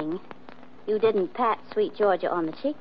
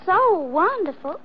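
mocking Southern sweetness, pitched up; mock Southern accent; 8 semitones higher than usual. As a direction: gush in a put-on Southern accent to needle him. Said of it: "like me with an accent".

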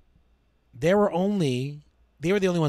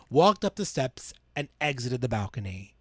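usual speaking voice

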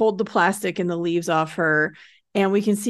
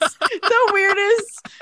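ordinary talk

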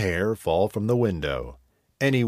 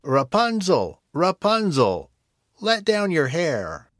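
high, slow witch call up the tower; 8 semitones higher than usual. Acted. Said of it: "calling out".

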